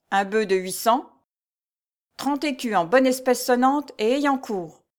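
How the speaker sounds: high, loud, measured roll call; pompous steward reading dues. A narrator's reading. Steward reading a tenant's dues aloud, pompous. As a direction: read the register like a proclamation.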